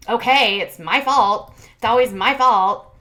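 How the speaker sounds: sarcastic self-pity. Retold or imitated. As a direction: ham up a sulking boyfriend. Mocking his defensive outburst.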